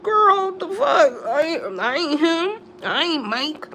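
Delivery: high, loud, mock-indignant denial; a light touch of sass, not really angry; sassy protest. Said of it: "and I'm like".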